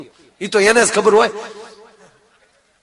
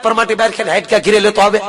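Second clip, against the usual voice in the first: fast complaint; 5 semitones higher, 8 dB louder, faster than usual. Retold or imitated. Wife complains rapidly.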